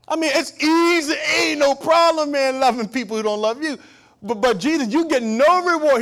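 loud, high, driving sermon voice; full-force preaching. Acted. Preacher rousing the congregation about God's power, loud preaching. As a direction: preach it hard to the back pews.

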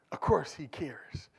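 slow hushed whisper; whispered aside. Shown as a demonstration. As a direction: drop to a breathy whisper. Repeats phrase then whispers it.

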